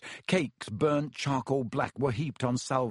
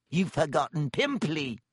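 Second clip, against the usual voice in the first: hissing; 3 semitones higher than usual. Acted. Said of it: "Peeves hissed in her ear".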